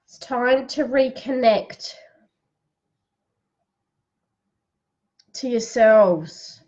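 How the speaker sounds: unhurried guided relaxation for the live audience; guided breathing. Acted. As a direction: lead them into calm, one phrase at a time. Leads a breathing exercise.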